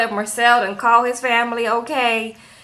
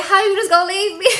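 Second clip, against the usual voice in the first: mock whiny plea; 7 semitones higher, faster than usual. [name] pleads as Marcel leaves.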